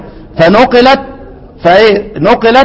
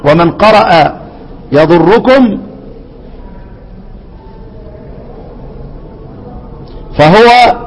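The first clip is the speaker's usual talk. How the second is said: poem recitation; slower than usual. Acted.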